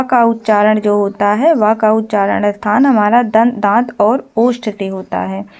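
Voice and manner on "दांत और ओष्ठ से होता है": usual speaking voice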